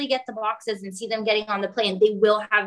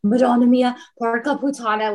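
mild Italian exclamation, a bit louder; Italian swearing; 2 semitones higher, 4 dB louder than usual. Retold or imitated. Giuseppe curses in the storm, mild Italian exclaiming. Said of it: "he's like".

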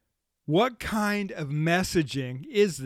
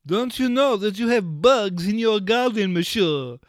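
hammed-up French accent, higher and louder; mock French accent; 5 semitones higher, 5 dB louder than usual. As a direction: play a fussy French gardener accent. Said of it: "then why not the French?".

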